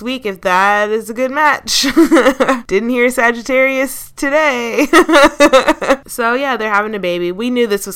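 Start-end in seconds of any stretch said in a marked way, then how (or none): 1.9-2.6 short burst of laughter; laughing. Real, not acted. Host amused by a zodiac match, laughing. 4.9-6.0 loud, high burst of laughter; laughing. Real, not acted. Host cracks up over Sagittarius joke, loud high laugh.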